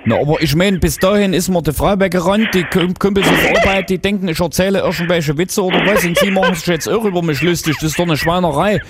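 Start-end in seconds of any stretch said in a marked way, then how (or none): none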